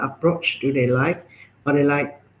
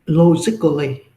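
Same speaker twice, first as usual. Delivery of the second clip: logically said louder and falling; stress on logically; 4 dB louder than usual. Shown as a demonstration. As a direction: lean on the word logically. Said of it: "So I must emphasize the word logically".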